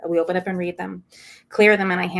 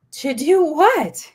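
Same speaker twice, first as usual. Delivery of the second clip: incredulous question; 9 semitones higher, 7 dB louder, much slower than usual. Retold or imitated. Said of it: "retorting to the angel".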